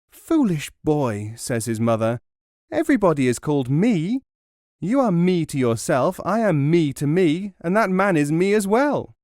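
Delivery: exasperated mother setting her son straight; scolding mother. Acted.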